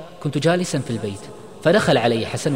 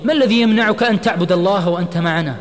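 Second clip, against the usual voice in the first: loud, high, pleading challenge; urgent cry; 8 semitones higher, 6 dB louder than usual. A narrator's reading. Friend grabbing Osama's hand, urgent loud cry. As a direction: call out to hold him back. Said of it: "هتف عماد وهو يمسكه من يده".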